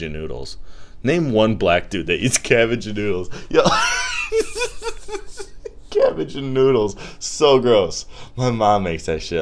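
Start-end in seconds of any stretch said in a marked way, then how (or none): 3.7-5.9 high screaming laugh fit; wild high-pitched laughing scream; shrieking laugh. Real, not acted. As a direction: crack up hard and high. Laughs at own joke.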